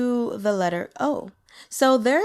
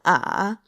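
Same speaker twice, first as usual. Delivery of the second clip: "ah" dropped low into vocal fry, lifting slightly; low dipping third tone; 2 semitones lower than usual. Shown as a demonstration. Teacher demonstrating Mandarin third tone, low fried dipping "ah". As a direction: sink "ah" to the lowest point, then raise a little. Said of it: "Third tone".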